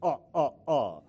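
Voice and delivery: cartoon count's spaced-out laugh; three separate mock-vampire "ah"s. Shown as a demonstration. Showing the congregation the laugh in a count-like voice. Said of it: "then we're gonna say".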